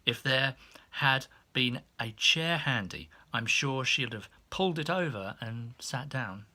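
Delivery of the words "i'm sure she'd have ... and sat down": usual speaking voice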